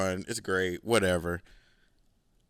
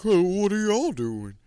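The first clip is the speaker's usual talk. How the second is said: much higher, louder fake-friendly call; imitating the landlord; 11 semitones higher, 5 dB louder than usual. Landlord coming over to the drinkers, high fake-friendly voice. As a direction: snoop while acting casual.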